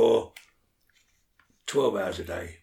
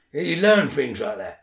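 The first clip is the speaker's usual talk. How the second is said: normal talking voice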